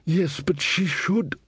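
fretful king trailing off; nervous young king. Acted. Verence interrupted.

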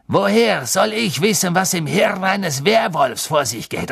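heated, high-pitched Snape; angry retort. A narrator's reading. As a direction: snap back with mounting fury. Snape furious, ordering Harry aside.

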